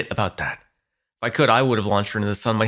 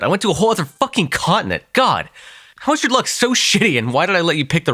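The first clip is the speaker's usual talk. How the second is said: higher, louder, exasperated venting; exasperated rant; 9 semitones higher, 4 dB louder than usual. Acted. Vampire venting at the hunter about her bad luck, exasperated. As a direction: throw up your hands in frustration.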